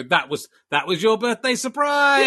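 high, drawn-out shouted announcement; mock birthday cheer. Telling a child about the birthday surprise, mock cheer. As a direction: sing out a slow, mock-celebratory reveal.

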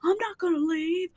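high, soft sneering dad; mocking dad voice. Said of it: "he's like".

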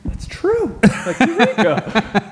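tiny high-pitched voice of dawning revelation; imitated wife's realization. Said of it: "she just has this look like".

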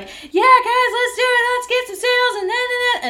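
high, loud sing-song sales cheer; loud coach hype. Retold or imitated. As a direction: pump the team up, loud and high. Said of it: "starts just talking really loud".

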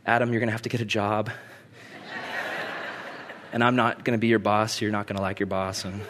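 low, subdued fatherly voice; gentle bad news. God telling Adam the consequences, low and subdued. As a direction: break it to him quietly, low and fast.

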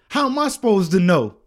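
imitating a loud, high protest; defensive coworker. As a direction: throw up a loud, indignant excuse. Coworker's retort in a quarrel.

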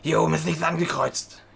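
lightly voiced officious clerk; a hint of a fussy clerk; objecting clerk. Clerk faulting the applicant's form, lightly mimicked objection.

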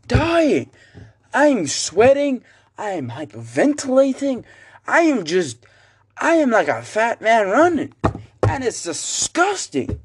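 high, strained, exasperated whine; shrill-pitched mock suffering; whiny complaint. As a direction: moan about the climb like you're dying. Panting up the hill, dying.

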